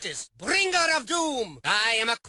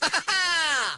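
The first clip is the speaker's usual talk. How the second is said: short theatrical villain cackle; brief evil "ha-ha-ha"; villainous laugh; 3 semitones higher than usual. Acted. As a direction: laugh in gloating triumph. Villain gloating in game lines.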